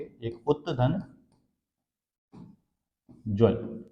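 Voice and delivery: उत्तधन और ज्वल slowly, as two separate pieces; slow split into parts. Shown as a demonstration. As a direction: break the word into उत्तधन, then ज्वल. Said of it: "ये शब्द बना है दो शब्दों से मिलके". (शब्द को धीरे-धीरे दो टुकड़ों में बोलें)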